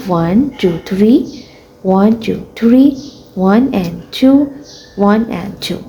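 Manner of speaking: ordinary talk, nothing marked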